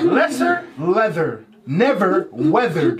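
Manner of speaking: slow, separated tongue twister; demonstrated reading. Shown as a demonstration. Host showing contestants the tongue twister, slow and deliberate. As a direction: lay out each pair of words deliberately. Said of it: "gotta do it like that".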